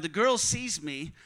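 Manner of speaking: ordinary delivery, nothing marked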